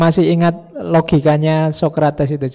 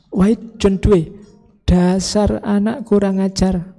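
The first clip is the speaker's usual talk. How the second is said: makian tiruan seorang ayah; 4 semitones higher than usual. Retold or imitated. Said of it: "awalnya maki-maki". (omelan ayah yang ditirukan, pelan dan bernada tinggi)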